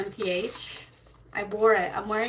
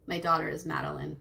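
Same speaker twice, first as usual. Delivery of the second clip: ordinary talk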